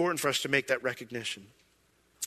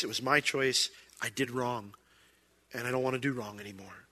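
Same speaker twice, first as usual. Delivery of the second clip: quiet confession; 4 semitones lower, 9 dB quieter than usual. Pretend. Voicing humble confession to God.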